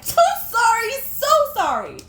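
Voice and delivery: high, loud embarrassed laugh-cry apology; laughing through tears. Real, not acted. Apologizing to viewers for crying, laughing sob. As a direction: laugh at yourself while crying. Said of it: "I'm crying in it".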